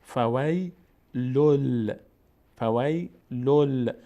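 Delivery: "فویلل" slowly, tanwin merged into a held L; joined double lam. Shown as a demonstration. Quran teacher joins the letters for learners, slow merged lam. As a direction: sound out "فویلل" with a long doubled L, no N. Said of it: "مستقیم میچسبونیم به لام بعدی".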